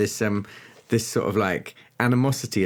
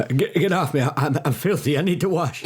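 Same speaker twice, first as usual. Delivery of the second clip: panicked; 6 semitones higher than usual. Acted. Pulled away from grab, panicked.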